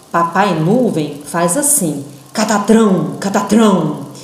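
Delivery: catatrão, catatrão said firm, syllables spaced; strong and spaced. Shown as a demonstration. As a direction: thunder it out like papa cloud. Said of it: "forma forte e pausada". (catatrão forte e pausado)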